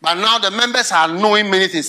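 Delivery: usual speaking voice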